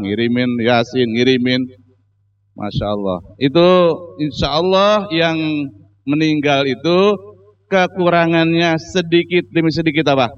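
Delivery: ordinary talk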